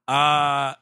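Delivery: ordinary talk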